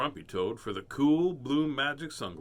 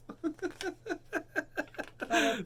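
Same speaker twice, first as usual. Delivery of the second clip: high cackle at own joke. Real, not acted.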